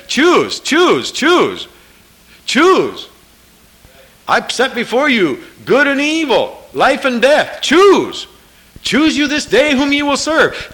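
high, loud preached call; fiery pulpit voice; preaching at full force. Acted. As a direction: thunder out God's command to choose.

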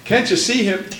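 normal talking voice

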